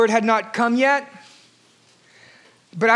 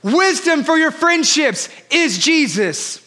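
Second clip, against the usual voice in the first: full-force preaching; 6 semitones higher than usual. Acted. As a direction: preach it out big to the congregation.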